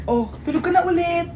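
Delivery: ordinary talk, nothing marked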